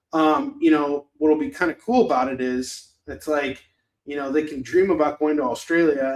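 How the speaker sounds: usual speaking voice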